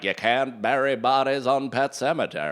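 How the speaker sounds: imitated Maine neighbour with local accent. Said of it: "That's the Maine accent".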